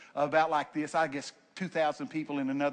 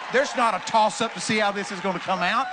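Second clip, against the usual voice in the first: ordinary talk, nothing marked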